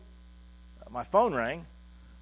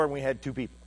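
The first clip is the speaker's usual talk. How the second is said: ordinary talk, nothing marked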